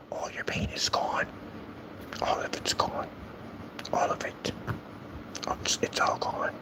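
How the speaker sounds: hushed fake comfort; mock-soothing whisper. Mock-soothing the upset trolls.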